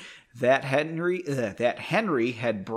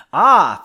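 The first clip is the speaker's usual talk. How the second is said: sudden loud scream of fright; 3 semitones higher, 11 dB louder than usual. Acted. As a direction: scream as he's grabbed. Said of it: "the man screamed".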